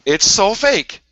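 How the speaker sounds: high, loud retort on "so fake"; loud insistence. Real, not acted. Co-host argues back after an insult, loud insistence. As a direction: raise pitch and volume to insist.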